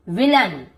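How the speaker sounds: "विलन" with short अ in the last syllable; short अ ending. Shown as a demonstration. Teacher modelling AI as अ, "विलन" ending -लन. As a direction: keep the end of "विलन" short, -लन. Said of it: "अ पढ़ रहे हैं इसे".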